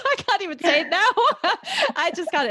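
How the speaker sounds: high, loud and helpless with laughter; laughing. Real, not acted. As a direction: laugh through your own failed attempt. Laughing at own failed accent.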